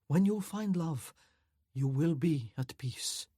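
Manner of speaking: put-on slow, peaceful voice with a Chinese accent; calm old master, light Chinese accent. A narrator's reading. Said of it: "slow, peaceful voice telling him in its pronounced Chinese accent".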